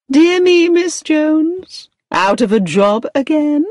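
high-pitched agency manager, rising to a question. A narrator's reading. Agency manager greets a jobless regular, high-pitched dismay.

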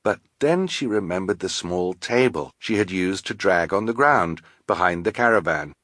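plain reading voice

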